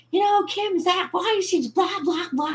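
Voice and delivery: very high mock chatter; put-on high babbling voice; nattering family voice. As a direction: imitate relatives prattling on. Imitates family talking about her.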